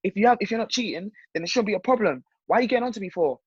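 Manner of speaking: mock girlfriend rant, pitched well above own voice; high-pitched retold complaint trailing into nonsense syllables; imitated indignant girlfriend. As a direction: raise the pitch and rattle on like an accusing partner. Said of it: "they won't see her self-destructive".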